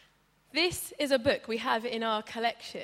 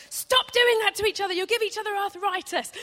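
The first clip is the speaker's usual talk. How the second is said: loud, higher scolding mum; mum shouting; 9 semitones higher, 9 dB louder than usual. Retold or imitated. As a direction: call out sharply from the next room. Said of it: "she would shout from the other room".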